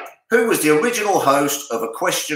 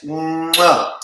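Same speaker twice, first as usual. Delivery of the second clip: big exaggerated "mwah" kiss noise; blown kiss; 4 semitones higher than usual. Host thanking a five-pound donor, showy blown kiss. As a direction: blow a smacking kiss down the mic. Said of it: "if you blow a kiss to J M S".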